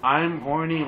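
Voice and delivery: ordinary delivery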